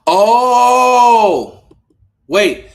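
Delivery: loud, high, drawn-out oh; shocked realization. Real, not acted. Host reads a surprising quote on stream, shocked. Stunned reaction to analyst's quote.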